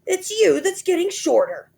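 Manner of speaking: high loud gloating husband; gloating Mister Twit. A narrator's reading. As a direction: tease her with nasty glee. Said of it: "grinning horribly".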